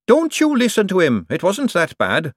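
high, sisterly golem voice. A narrator's reading. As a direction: stick up for her sister. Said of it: "with sisterly fellow feeling".

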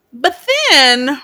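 ordinary talk

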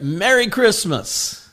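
loud, high, drawn-out proclamation; bold declaration. Shown as a demonstration. Pastor urging believers to declare it everywhere, loud bold declaration. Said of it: "Declare it".

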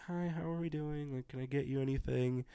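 softly voiced, unassuming waitress; quiet waitress. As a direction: imitate a reserved server, keeping it small. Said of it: "she was kinda quiet".

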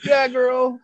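very high fake-supportive call; mock cheering on. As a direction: hoot it up high like a mocking cheer. Repeats his mocking heckle.

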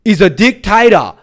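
loud, high-pitched ranting impression; Harvey Yemeni. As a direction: shout each accusation in a put-on voice. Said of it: "He talks like this".

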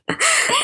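high-pitched giggle; helpless squeaky giggle at yourself; embarrassed laugh. Real, not acted. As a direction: burst into high, self-conscious laughter. Embarrassed at own odd voice.

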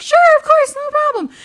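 very high, loud, eager yes; exaggerated high-pitched customer-service voice; chirpy agreement. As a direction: sing out a willing reply. Retold cheerful yes to walk-in.

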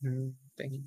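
awkward quiet thanks. Pretend. Thanking birthday guests while wishing it would end. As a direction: mumble thanks to get it over with. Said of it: "I literally will just sit there and be like".

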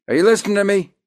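high, loud Brooklyn tough guy; Brooklyn accent shout. Pretend. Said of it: "learn how to talk with a Brooklyn accent".

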